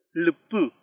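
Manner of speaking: lupu with a subtle first vowel coloured by the L; vowel after consonant. Shown as a demonstration. Teacher gives an example with L, careful model of lupu. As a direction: demonstrate lupu carefully as one isolated word. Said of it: "pronuncia este sonido".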